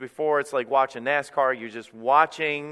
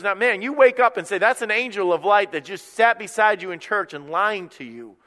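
loud, high, urgent exhortation; rally them with raised voice; full-force preaching; 7 semitones higher, 6 dB louder than usual. Real, not acted. Preacher urges the church to call out the deceiver, preaching at full force.

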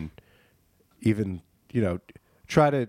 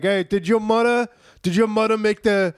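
higher, louder, exaggerated Italian American; mock Italian American accent; 7 semitones higher, 4 dB louder than usual. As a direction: ham up a New York Italian guy. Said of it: "they say the same things. It's like".

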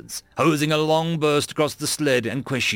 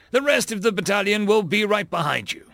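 raised, higher-pitched commanding voice; shouted order; 7 semitones higher than usual. A narrator's reading. Colonel promises the battalion will follow, shouting. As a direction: call out reassurance as an order. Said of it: "Michaels shouted".

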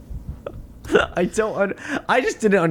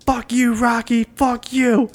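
angry yell; 7 semitones higher, slower than usual. Retold or imitated. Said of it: "comes busting through like".

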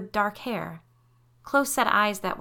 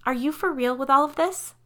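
put-on high, loud incredulous teen; teenager; 8 semitones higher, 5 dB louder than usual. A narrator's reading. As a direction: ask it like an exasperated teenage girl. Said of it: "sounding every bit the teenager she was".

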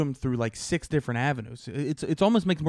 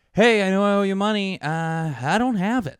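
high, loud admission of being broke; loud mock confession; 8 semitones higher, 7 dB louder than usual. Telling a bill collector there's no money, loud and high. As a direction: call it out, pitched up and unashamed.

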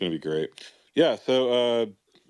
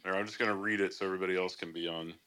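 usual speaking voice

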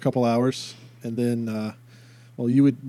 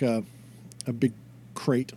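ordinary talk, nothing marked

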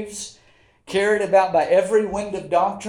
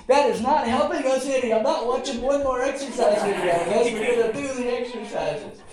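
higher-pitched, mildly exasperated retold refusal; fed-up wife; 4 semitones higher than usual. Wife refusing husband more exercise videos, mock exasperated. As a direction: lightly imitate a wife putting her foot down.

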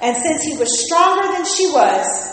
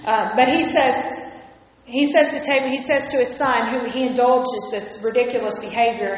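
normal talking voice

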